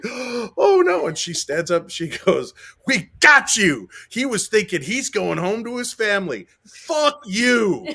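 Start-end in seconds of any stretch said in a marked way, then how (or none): 0.0-1.1 high, loud mock-girlish alarm; little girl's dismay. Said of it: "any other little girl in the world would be like". 2.9-7.9 put-on high, loud little-girl taunt; imitated gloating granddaughter. Imitating granddaughter taunting trapped mouse.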